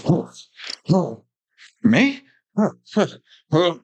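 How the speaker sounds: hammed-up startled gasps, pitch shooting up on "me"; overacting boss. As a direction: overact being choked, wildly melodramatic. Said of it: "overacting everything".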